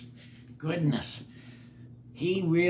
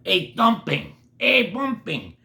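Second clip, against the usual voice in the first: building excitement; 12 semitones higher, 4 dB louder than usual. A narrator's reading. Rising excitement in narration.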